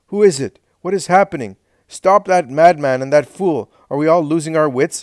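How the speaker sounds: high, loud, alarmed shouting. A narrator's reading. Helkai shouts at the servants amid the uproar. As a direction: bark out orders over the chaos. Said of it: "shouts Helkai".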